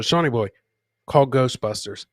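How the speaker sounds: normal talking voice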